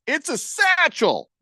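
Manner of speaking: high, slow, drawn-out line; Alan from Hangover voice. Acted. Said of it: "In my best Alan from Hangover voice".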